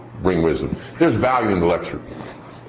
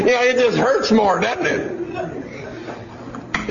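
laughing; 13 semitones higher, much slower than usual. Real, not acted. Laughing at the shot story.

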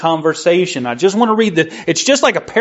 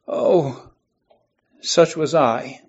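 sorrowful; 5 semitones lower, 6 dB quieter, slower than usual. Acted. Marley's regret, cry heard.

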